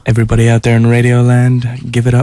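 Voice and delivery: loud DJ announcer; radio voice. Pretend. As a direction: boom it out like a radio host. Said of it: "in your best radio voice".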